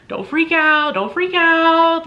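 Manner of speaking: high, drawn-out self-pep talk; panicked self-soothing. Retold or imitated. Telling herself not to panic.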